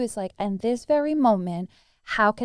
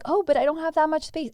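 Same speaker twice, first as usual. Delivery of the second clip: higher, quick mock whine; mock complaint; 6 semitones higher, faster than usual. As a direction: voice the student's worry, lightly.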